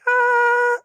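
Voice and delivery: very high, sustained falsetto note. Shown as a demonstration. Singer showing what falsetto is, powerless high falsetto. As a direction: hold a high "Ah" with no power behind it. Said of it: "falsetto is like".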